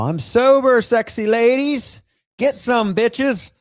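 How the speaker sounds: high, loud yelled boast; mock street yell. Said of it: "You can't just yell".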